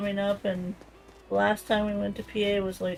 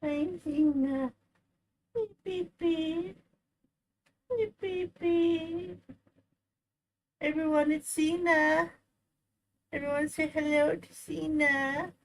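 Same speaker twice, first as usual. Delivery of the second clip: dote on the kitten; 5 semitones higher than usual. Real, not acted. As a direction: owner cooing to the cat. Greeting cat Zena.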